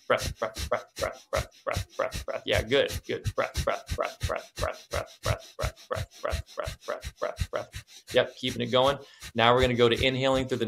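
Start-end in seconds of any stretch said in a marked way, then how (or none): 0.1-7.8 quick unvoiced popping breaths; faster sharp exhales. Shown as a demonstration. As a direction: speed the pops up. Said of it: "Just try to go faster".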